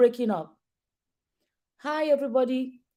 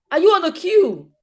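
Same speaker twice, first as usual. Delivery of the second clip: raised voice calling a caller; 8 semitones higher, 3 dB louder than usual. Real, not acted. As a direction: call out loud across a bad line. Calling out to a caller who cannot hear.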